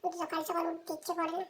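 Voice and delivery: low, soft grumbling; child muttering to self about not getting up; sulky mumble. Acted. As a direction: grumble under the breath.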